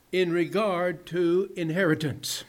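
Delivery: usual speaking voice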